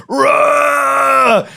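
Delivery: a high, loud war cry as he leaps; battle scream. Retold or imitated. Brother jumping for the fifth rung, screaming at the top of his lungs. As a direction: scream it from the gut at full volume. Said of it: "he literally screams at the top of his lungs".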